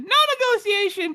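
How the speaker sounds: high called-out echo; crowd calls out. Acted. Citizen calls back to the king, high shouted echo. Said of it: "someone called".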